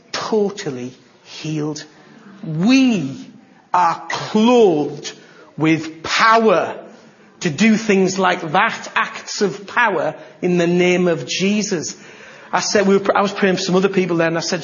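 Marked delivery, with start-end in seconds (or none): 2.4-12.0 loud, slow preaching emphasis. Real, not acted. Preacher proclaiming power to church, loud and slow. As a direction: declare it to the congregation.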